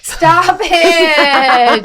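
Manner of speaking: high, loud protest through laughter; laughing shout. Real, not acted. Teased over geriatric Gillette, laughing shout. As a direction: shriek it while cracking up.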